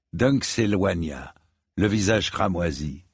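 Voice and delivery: ordinary delivery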